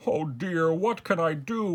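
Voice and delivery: high-pitched fretting; dismayed king. A narrator's reading. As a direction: wail your helplessness. King despairs over the hat.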